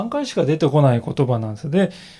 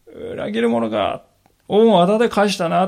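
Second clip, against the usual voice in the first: imitated angry rebuke; 4 semitones higher, 4 dB louder than usual. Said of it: "私たちだったら言ってしまうんじゃないでしょうかね".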